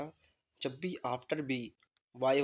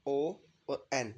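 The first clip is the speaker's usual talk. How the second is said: ओ और एन spelled out very slowly, letter by letter; very slow letter-by-letter spelling; much slower than usual. Shown as a demonstration. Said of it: "ये आपका हो गया".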